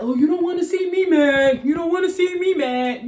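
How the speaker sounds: high, loud mock threat; mock tough talker. Mocking people who brag about their temper, loud and high. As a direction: puff up like a fake tough guy. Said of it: "some people like".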